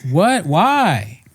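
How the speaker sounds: high-pitched, bewildered reaction; acted dismay. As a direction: react in disbelief, voice jumping up. Acts out shock at profile picture.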